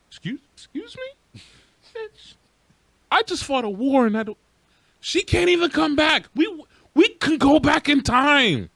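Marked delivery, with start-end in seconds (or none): 0.1-4.4 mock indignant. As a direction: act scandalized by the insult, pitched way up. Indignant reply to She-Hulk's insult.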